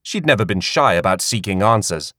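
plain reading voice

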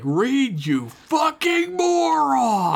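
high, slow hammed-up screaming rant; mock furious yell. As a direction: scream it at the incompetent crewmate. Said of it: "And she's like".

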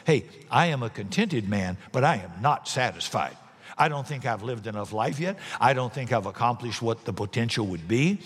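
ordinary delivery

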